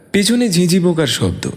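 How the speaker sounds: ordinary delivery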